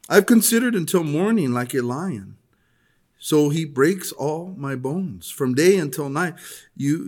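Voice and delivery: measured, solemn Bible reading voice; scripture reading. Acted. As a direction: read the passage out to the church with weight. Reads Hezekiah's lament aloud.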